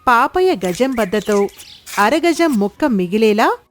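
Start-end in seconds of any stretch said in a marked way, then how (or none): none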